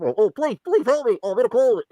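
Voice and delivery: hammed-up cries for help, pitched high; mock panic. Vlogger mocking a shaky video as a plane crash, hammed-up panic. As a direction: play a passenger on a falling plane, hammed-up.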